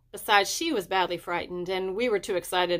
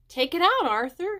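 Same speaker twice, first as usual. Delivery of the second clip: urging call; 10 semitones higher, 4 dB louder than usual. A narrator's reading. Henry urging Arthur to look.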